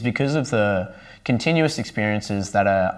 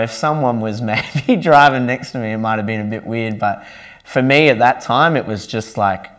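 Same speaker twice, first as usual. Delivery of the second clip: ordinary talk, nothing marked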